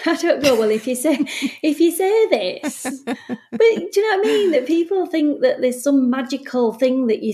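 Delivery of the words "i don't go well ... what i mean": amused laughing. Real, not acted. Bereavement worker joking she has no script, laughing. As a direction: talk through real laughter.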